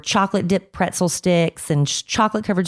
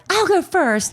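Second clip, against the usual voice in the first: put-on eager girl; 13 semitones higher, slower than usual. Eager girl voiced high.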